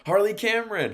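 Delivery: thrilled fan shout; excited. Real, not acted. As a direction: hype her name like a fan. Excited about a wrestler's return.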